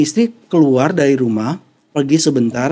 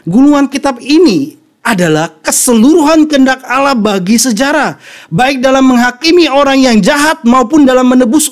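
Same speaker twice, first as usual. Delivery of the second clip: raised, emphatic pulpit delivery; forceful preaching; 7 semitones higher, 5 dB louder than usual. Acted. Preacher explaining the scroll of God's will to congregation, forceful preaching. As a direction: proclaim it loud and high, stretching key words.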